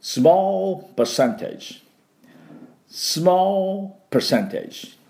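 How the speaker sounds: drawn-out small percentage to repeat after; repeat-after-me model; slow model English. Shown as a demonstration. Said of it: "発音いきましょう".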